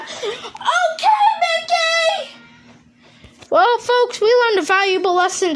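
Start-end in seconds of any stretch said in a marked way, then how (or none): 0.0-2.4 high, whimpering; whiny cry. Acted. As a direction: whine it like a hurt child. Crying complaint.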